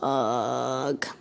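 low, drawn-out groan; frustrated groan. Real, not acted. Speaker losing their train of thought, low frustrated groan. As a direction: let out a long, low "ugh" of annoyance. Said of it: "this is pissing me off".

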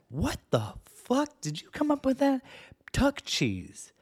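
high, slow, stunned "what the fuck"; disgusted disbelief. Real, not acted. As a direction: stretch it out in horrified disbelief. Disgusted reaction to tuck cheese.

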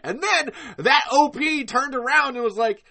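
ordinary delivery

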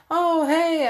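high, loud imitated greeting; dad's phone greeting. Dad answering his daughter's call, high loud greeting.

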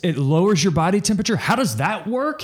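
upbeat commercial announcer voice; mattress ad pitch. Pretend. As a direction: sell the gadget like a TV ad. Joins the mock mattress ad.

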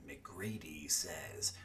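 low, hushed, drawn-out noir growl; slow, whispery, grating noir narration; noir narrator voice. Shown as a demonstration. Podcast host demonstrates his third-person narrator, grating noir voice. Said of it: "the noir voice is a little grating".

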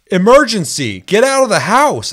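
loud, high robotic alarm announcement; mock emergency alarm voice. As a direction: blare it like a smoke detector's recorded voice. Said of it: "I hear a guy go".

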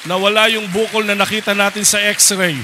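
loud, exultant proclamation; preacher shouting that the tumour vanished, exultant; triumphant shout. Real, not acted. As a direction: shout the miracle to the crowd. Joyful shout of healing.

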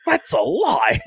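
playful accusing tone; mock accusation. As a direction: tease him with it.

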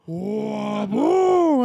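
very loud, high sound-effect boom. Retold or imitated. The cousin smacks him awake with a pillow, a loud high boom. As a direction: slam the word like a pillow hit.

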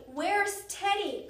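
slow, high anxious cry; worried cry. A narrator's reading. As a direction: drag it out in alarm. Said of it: "cried Jack".

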